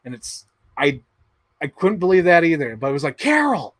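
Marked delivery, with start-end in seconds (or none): 3.2-3.7 high whiny husband calls, rushed in the middle; high pleading calls, speeding up; husband at the movies calling his wife, high and whiny. Retold or imitated.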